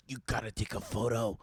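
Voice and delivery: low, soft urgent urging; quoting Rhonda Kramer. Retold or imitated. Friend insisting on a photo with Vin, hushed urgent tone. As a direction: mimic an insistent friend's nudge. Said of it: "she's like".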